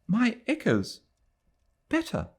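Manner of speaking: put-on a shaken girl easing; fragile young woman. A narrator's reading. Adela high and fragile.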